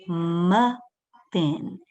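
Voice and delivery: "muffin" as muh, pause, fin; split into syllables. Shown as a demonstration. Teacher has children feel the mouth open, saying "muffin" in two slow beats. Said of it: "Let's say the word".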